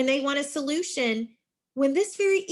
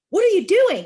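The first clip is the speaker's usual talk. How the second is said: what are you doing, high and loud like an angry exclamation; shouted accusation; 10 semitones higher, 10 dB louder than usual. Shown as a demonstration. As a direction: bark it like an exclamation mark.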